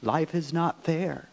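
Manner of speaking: slow, flat-toned voice of despair; a slow, put-on voice of the stranger within; inner voice of the stranger. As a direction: let the doubt speak slowly. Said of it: "another voice that we hear from within us".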